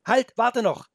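put-on high, loud boy's cry; urgent shout. The boy shouts to stop the wolf, high and loud. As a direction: call out urgently to stop him. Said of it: "rief Felix in letzter Sekunde".

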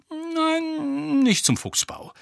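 high, drawn-out no, then falling; Lupin's denial. A narrator's reading. Lupin answering Harry's guess, high voice falling. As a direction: let him down with a falling tune.

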